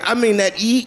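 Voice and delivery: ordinary talk, nothing marked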